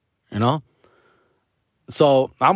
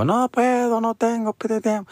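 imitating mom; 11 semitones higher than usual. Son imitates mom brushing off his request, high put-on voice. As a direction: mimic mom's high excuse, trailing off. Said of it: "She drives, she fucking go".

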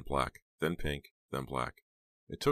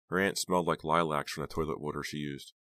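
plain reading voice

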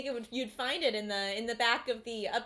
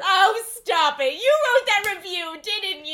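loud coy delighted protest; gushing playful scolding; flattered squeal; 6 semitones higher, 10 dB louder than usual. Acted. Flattered wife teasing husband.

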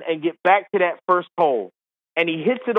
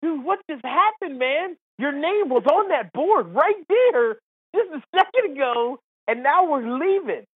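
high-pitched, retold exasperated plea; incredulous protest; 8 semitones higher than usual. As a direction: plead in acted disbelief, pitched well up. Protests to golfer in retold scene.

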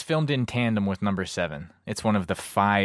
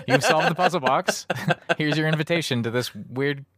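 game announcer voice; 7 semitones higher than usual. Retold or imitated. Voicing the game's prompts.

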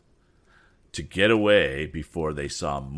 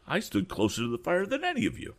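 put-on much higher, fast boyish boast; boastful boy; 13 semitones higher, faster than usual. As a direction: brag like a cocky lad. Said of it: "added Pete Bailey".

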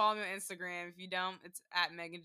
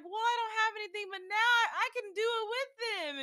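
high, excited imitation; happy mom; 12 semitones higher than usual. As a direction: play a mom gushing over her new gift. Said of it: "she was so happy".

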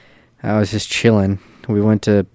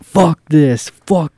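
louder, higher, drawn-out angry cursing; retold yelling; 6 semitones higher, 5 dB louder, much slower than usual. Turned-away traveler cursing at the airport, retold yelling. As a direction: imitate a man screaming curses, part strength. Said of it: "He's fucking yelling, screaming".

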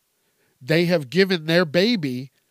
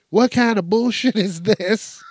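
raised, louder mock yell at the Monopoly board; half-playful yell, pitched up and louder; retold yell; 7 semitones higher, 5 dB louder than usual. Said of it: "where you're yelling at your sister".

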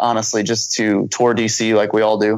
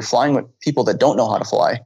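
usual speaking voice

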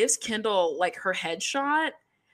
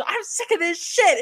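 higher, louder mock outburst; mock yell; 10 semitones higher, 4 dB louder than usual. Imitating Abby's outburst in the cowboy hat fight, a hammed-up yell. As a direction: yell it like a coach throwing a shoe. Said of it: "And she go".

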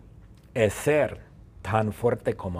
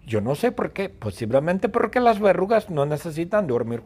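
normal talking voice

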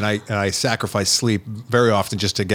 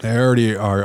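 ordinary delivery

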